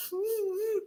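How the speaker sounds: soft, high catch of breath; sharp gasp. Retold or imitated. Daughter stopping herself from crying, quick gasp. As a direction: suck the tears back in with a gasp. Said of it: "I'm like".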